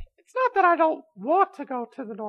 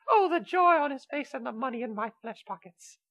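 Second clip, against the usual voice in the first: normal talking voice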